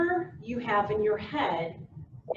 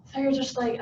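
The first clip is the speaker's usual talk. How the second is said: ordinary delivery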